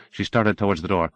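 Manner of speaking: ordinary delivery, nothing marked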